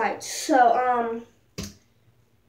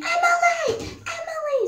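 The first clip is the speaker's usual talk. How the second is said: high shouted calls, much higher; screaming a name. Retold or imitated. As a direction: yell her name into the distance.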